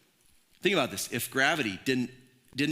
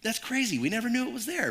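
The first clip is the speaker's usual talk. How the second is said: pitched up, mock wonder at a discovery; preacher mocking a flashlight discovery with pretend wonder; mock amazement; 8 semitones higher than usual. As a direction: play it as a pretend gasp of discovery. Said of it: "we don't shine a flashlight like".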